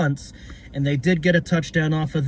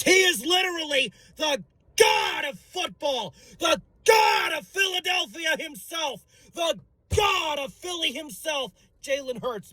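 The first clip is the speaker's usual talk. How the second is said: much higher, roaring hype; ecstatic shouting; 10 semitones higher than usual. Acted. Fan hailing Jalen Hurts as god of football, shouting. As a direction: preach it like a worshipper.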